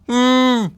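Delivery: very high, muffled cry under snow; muffled hum. Acted. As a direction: hum as if buried. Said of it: "The muffled cry came again".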